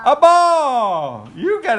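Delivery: excited praise. Real, not acted. Praising toddler's answer.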